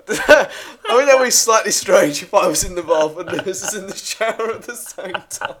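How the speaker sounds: high, loud, giggling through the words; laughing. Real, not acted. Picturing friends in bath and shower, laughing. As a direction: try to explain through real laughter.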